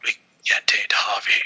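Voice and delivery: raspy whispered Batman with a cold; hoarse stuffy whisper, like a bad cold.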